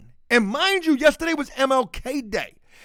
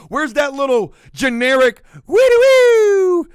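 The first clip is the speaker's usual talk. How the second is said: loud, slow, drawn-out mock western tune; louder, slower western whistle bit; cowboy whistle imitation; 5 semitones higher, 9 dB louder than usual. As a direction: hum a spaghetti western sting.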